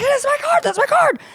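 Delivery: very high, giddy mock squeal; acted-out uncontainable thrill; retold excitement. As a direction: squeal it like an overjoyed fan. Retelling her thrilled reaction.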